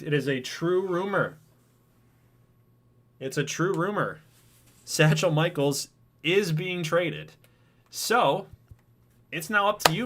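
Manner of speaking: excited streamer reveal; hyped announcement. Real, not acted. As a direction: sell the news like a stream host. Announcing the traded player to chat.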